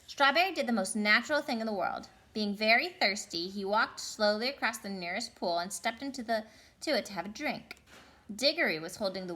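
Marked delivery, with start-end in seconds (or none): none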